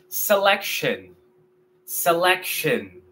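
selection slowly, se-lek-shun; English pronunciation. Shown as a demonstration. Teacher modeling English for students, slowly. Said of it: "en inglés".

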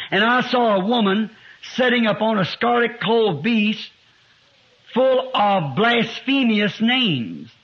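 deliberate verse, key words drawn out; emphatic scripture reading. Real, not acted. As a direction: read it out slowly, stretching the final phrase. Reads scripture aloud.

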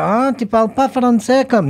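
high-pitched mock objection; mock protest. Casting director balking at a foreign actor, mock protest. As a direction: act out a fussy objection, voice jumping up.

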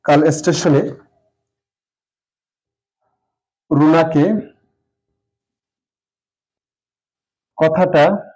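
word by word, long pauses while writing; slow dictation. Shown as a demonstration. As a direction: spell it out slowly as you write the sentence. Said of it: "একটি বাক্য সাজিয়ে লিখি". (লেখার সময় ধীরে ধীরে বলা)

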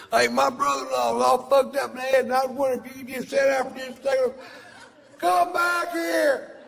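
screeching high mock yell, slowing at the end; yelling fan. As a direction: shriek after him like a pushy fan. Fan yelling after Doc.

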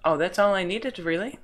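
high, loud, incredulous complaint; can't believe the game did that; exasperated disbelief. Real, not acted. Player outraged at a stuck item, loud disbelief.

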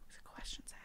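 soft unvoiced whisper. Real, not acted. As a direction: breathe the words out. Whispered aside.

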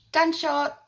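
high, loud shouted Gunshot; shouting to claim the front seat; mock yell. Mum yelling Gunshot to her sons at the car, loud and high. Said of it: "used to yell out".

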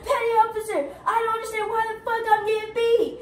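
high-pitched wailing protest; mock crying. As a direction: imitate a tearful recruit protesting innocence. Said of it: "he started crying".

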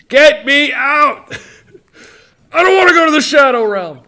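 desperate high-pitched anime scream; Joey Wheeler. Acted. Sidekick begging his friend for rescue, screaming in panic. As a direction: shriek in hammed-up panic, drawing out the cries. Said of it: "my impression of Joey Wheeler".

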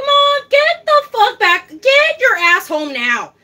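mock angry yell at mom. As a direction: bark it at her like a fed-up daughter. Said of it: "I was like".

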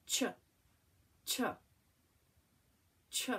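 "Ch" as a short isolated chuh; letter sound. Shown as a demonstration. Teacher showing C before H, short isolated sound. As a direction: separate clean bursts of "Ch". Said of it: "What sound do these make?".

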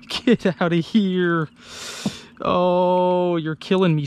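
usual speaking voice

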